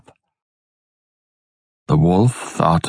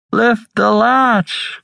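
put-on higher, slow, frail old-woman call; old grandmother; 13 semitones higher, slower than usual. A narrator's reading. Sick grandmother calling to the door, high and slow. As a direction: call out weakly from a sickbed.

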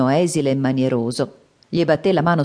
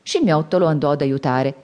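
ordinary delivery, nothing marked

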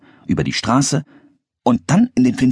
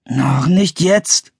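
drawn-out, higher-pitched reluctant groan; 9 semitones higher, 3 dB louder, much slower than usual. A narrator's reading. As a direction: groan it slowly, putting them off. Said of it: "stöhnte er". (gedehnt stöhnend)

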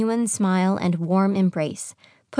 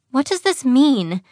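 higher, tense question; anxious and pitched up; alarmed; 6 semitones higher, 4 dB louder than usual. A narrator's reading. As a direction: ask with rising worry. Evelyn, tense, fears the change.

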